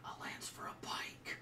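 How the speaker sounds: hushed, unbelieving dwarf whisper; whispered aside. Acted. Dwarf smith confiding to the female dwarf, whispered aside. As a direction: whisper it across the shop to a colleague. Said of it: "and he's like".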